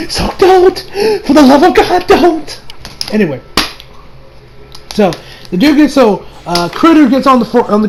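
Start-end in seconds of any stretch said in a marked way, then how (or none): 0.1-2.6 high-pitched mock begging; comically desperate pleading; exasperated plea. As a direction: beg them to stop, voice pitched way up. Begging the writer to stop.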